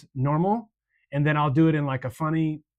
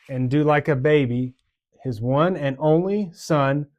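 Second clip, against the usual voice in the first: slow, spaced phrases, louder on each beat; slow, phrase by phrase, pausing between hand motions; 4 dB louder, slower than usual. Shown as a demonstration. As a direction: lay it out phrase by phrase for kids. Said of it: "motions ... go like this".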